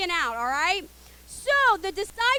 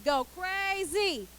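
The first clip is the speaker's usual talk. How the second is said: ordinary delivery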